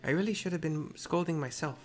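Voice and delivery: put-on Tepeke's mixed accent. Tepeke scolds the wandering girl in a mixed accent. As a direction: scold the child gently in a put-on foreign accent. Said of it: "the accent I've chosen".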